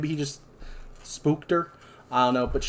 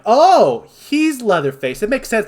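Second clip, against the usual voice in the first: high, loud "oh" as it dawns; mock realisation; 8 semitones higher, 6 dB louder than usual. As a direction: act out a viewer suddenly getting it. Acting out a viewer's sudden realisation.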